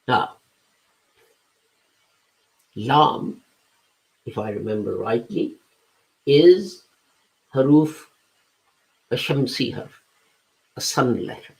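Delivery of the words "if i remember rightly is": ordinary talk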